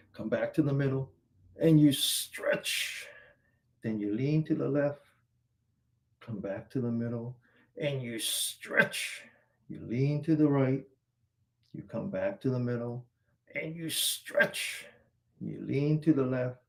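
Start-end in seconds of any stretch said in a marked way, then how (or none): 1.6-3.1 drawn-out cue. Shown as a demonstration. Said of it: "coach draws out the cue". 7.8-9.2 drawn-out cue. Shown as a demonstration. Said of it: "coach draws out the cue". 13.6-14.9 drawn-out cue. Shown as a demonstration. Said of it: "coach draws out the cue".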